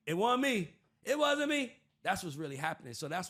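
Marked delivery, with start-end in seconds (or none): 0.1-0.7 mock denial. 1.0-1.7 mock denial.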